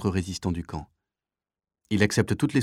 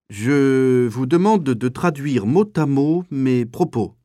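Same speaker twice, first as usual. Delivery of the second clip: slow, loud, drawn-out and higher; stumbling speech; 5 semitones higher, 6 dB louder, slower than usual. Acted. SS officer addressing the translators, slow stumbling oration. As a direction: drag the words as you fumble through them. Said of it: "commence-t-il en bafouillant".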